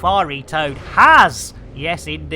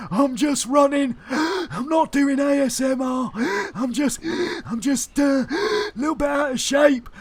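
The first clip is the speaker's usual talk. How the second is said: higher, wheezing gasps between phrases; out-of-breath ASMR smoker; 6 semitones higher, 4 dB quieter than usual. Acted. Fifty-five-year-old smoker out of shape from running, mock wheezing. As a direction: play a winded old smoker gasping for air. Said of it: "fifty-five-year-old A S M R smoker".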